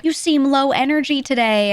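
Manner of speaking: high, slow imitation of a concerned viewer; exaggerated pitying remark; mock concern. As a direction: stretch it out with fussy sympathy. Mimics people commenting on low energy.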